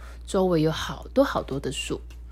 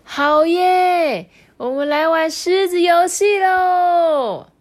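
much higher, louder, slow cheer; cheering shout; 12 semitones higher, 8 dB louder, much slower than usual. Acted. The friends cheering for the lion game, excited. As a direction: cheer as a happy group. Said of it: "大家都喊着说".

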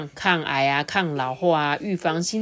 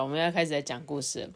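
plain reading voice

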